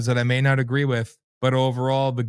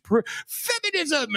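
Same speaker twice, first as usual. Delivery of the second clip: much higher, slow mock screech; 3 dB quieter than usual. Host mocking protesting women with a high slow screech. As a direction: shriek it like a protester. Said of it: "when the women screech".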